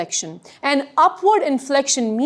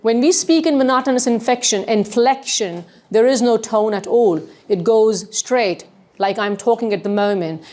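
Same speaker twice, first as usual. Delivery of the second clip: no tone at all, going straight along; monotonous inflection. Shown as a demonstration. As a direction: speak on one level pitch, no rise or fall. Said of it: "It's called the monotonous inflection".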